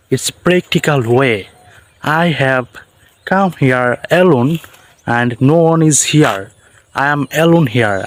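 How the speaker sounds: ordinary talk, nothing marked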